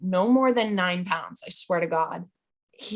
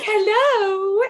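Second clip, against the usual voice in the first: much higher singsong hello; high pet greeting; 13 semitones higher, 4 dB louder than usual. Acted. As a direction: coo hello to the pets. Greeting pets coming home drunk.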